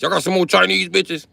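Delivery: high mock soldier calling out; a hammed-up veteran yelling the question; mock baby boomer soldier. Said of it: "they said Chinese".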